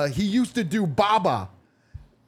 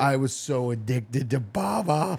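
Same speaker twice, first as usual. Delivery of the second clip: slow, quiet, lower mock Bangladeshi accent; soft slow driver voice; 5 semitones lower, slower than usual. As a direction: drag it out in a muted put-on Bangladeshi accent. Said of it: "not doing the accent".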